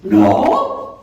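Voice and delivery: loud, indignant denial rising high; defensive. Acted. Someone told they look angry denies it, defensive. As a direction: snap back in high-pitched, hammed-up outrage. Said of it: "o estamos defensivos".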